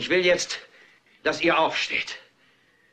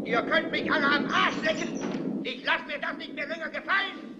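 strained yell; 10 semitones higher, 6 dB quieter than usual. Acted. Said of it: "schreit und schreit und schreit".

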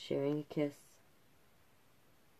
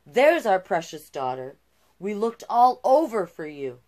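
loud, hearty king and queen calling out; booming royal parents; 4 semitones higher, 10 dB louder than usual. Acted. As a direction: call out loudly, delighted to find her.

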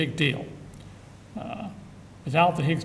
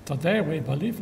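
lilting mock Swedish accent; clumsy sing-song Swedish accent, soon abandoned; bad Swedish accent. Shown as a demonstration. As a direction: make a half-hearted stab at a Swedish accent. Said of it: "uh, a Swedish accent".

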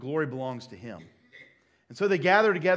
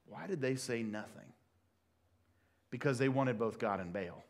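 normal talking voice

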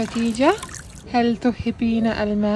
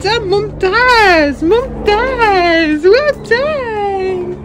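excited praise; 13 semitones higher, much slower than usual. Real, not acted. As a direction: cheer her on, high. Praising toddler's success.

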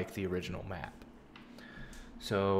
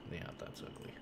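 whispered aside; 15 dB quieter than usual. Real, not acted. Muttered aside to self.

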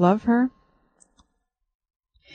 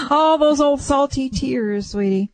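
tender comfort; 5 semitones higher, slower than usual. Real, not acted. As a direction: gently coax the tears out. Comforting a crying caller.

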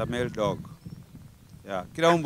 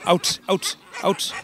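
firm dog commands; 6 semitones higher, slower than usual. Real, not acted.

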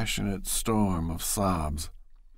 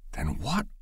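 hopeless; 6 semitones higher than usual. A narrator's reading. Bashford asks Loretta in a hopeless near whisper. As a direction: ask it with all hope gone. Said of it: "he asked in a hopeless voice".

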